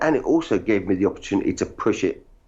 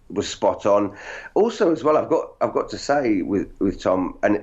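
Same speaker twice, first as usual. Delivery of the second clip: normal talking voice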